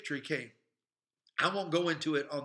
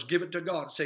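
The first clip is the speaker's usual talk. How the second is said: ordinary delivery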